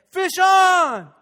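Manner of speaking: loud, high shout of fish on; shouted call. Retold or imitated. Deckhand friend to the pastor, loud high shout. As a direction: bellow it across the deck. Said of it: "I hear from this side".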